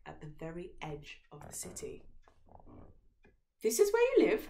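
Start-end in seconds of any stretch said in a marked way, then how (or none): 3.6-4.5 very high, loud shocked question; horrified princess. A narrator's reading. Princess horrified at the rundown shack. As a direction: recoil in horror. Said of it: "asked the princess".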